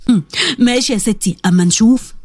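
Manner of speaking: husband's low, scoffing doubt; mocking scoff. A narrator's reading. Husband scoffing at his wife's diet plan, mocking. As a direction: brush her off with a sneer. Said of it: "أحمد ضحك باستهزاء".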